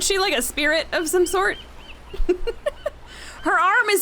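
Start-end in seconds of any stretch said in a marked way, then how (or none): 2.5-4.0 real laugh in a high voice. Real, not acted. Reacting to the severed arm, laughing. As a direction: laugh through the words, pitched up.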